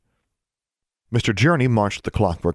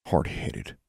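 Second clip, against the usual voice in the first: low, soft grumble; muttered; 4 semitones lower, 7 dB quieter than usual. A narrator's reading. David grumbles as his friend leaves, muttered. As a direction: mutter it under your breath. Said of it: "David muttered".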